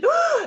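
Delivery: high, loud mock-horrified "oh"; shocked gasp. Reacting to her client's news about stolen gifts, exaggerated shock.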